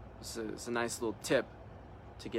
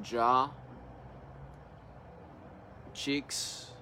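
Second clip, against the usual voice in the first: calm meditation leader leaving room to breathe; guided meditation; 5 dB quieter than usual. Real, not acted. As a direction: guide them into relaxing, unhurried, one short instruction at a time. Leads a guided relaxation meditation.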